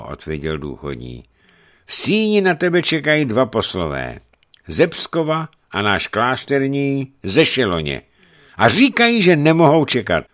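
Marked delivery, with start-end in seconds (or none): 1.9-10.2 high, loud report; loud announcement. A narrator's reading. Servant announcing two waiting messengers, loud and raised. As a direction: call the news across to him.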